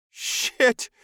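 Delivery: high, loud gasp of shock. A narrator's reading. Captive man seeing an alien, shocked gasp. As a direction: gasp it out in alarm. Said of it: "the man gasped".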